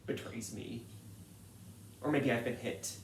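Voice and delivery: ordinary delivery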